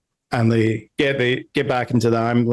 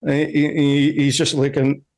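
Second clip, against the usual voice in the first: usual speaking voice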